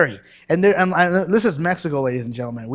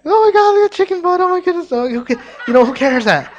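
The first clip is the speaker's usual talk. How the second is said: much higher, louder gushing ditzy voice; mocking airhead gush; 14 semitones higher, 7 dB louder than usual. Preacher mocking believers distracted by trivia, ditzy squeal. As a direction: squeal like someone easily distracted.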